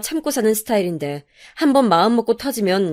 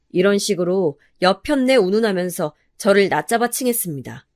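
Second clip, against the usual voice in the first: plain reading voice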